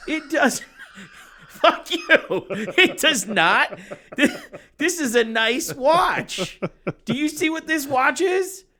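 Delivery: high, loud, laughing protest; laughing outrage. Real, not acted. Mock outrage defending his watch.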